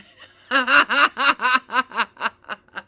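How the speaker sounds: very loud burst of real laughter. Real, not acted. Mother recalling she and her daughter got through it, loud laugh. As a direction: let out a long, rolling belly laugh.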